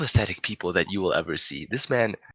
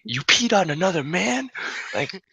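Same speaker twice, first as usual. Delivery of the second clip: put-on indignant boyfriend; 11 semitones higher, 5 dB louder than usual. Jealous boyfriend confronting Mary.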